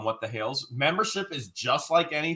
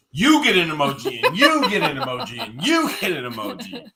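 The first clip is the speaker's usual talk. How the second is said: excited giveaway shout; 5 dB louder than usual. Pretend. Giveaway-host style handing out emojis.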